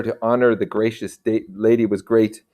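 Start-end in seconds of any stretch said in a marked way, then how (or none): none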